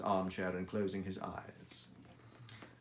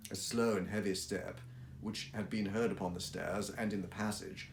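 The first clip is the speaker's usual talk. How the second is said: usual speaking voice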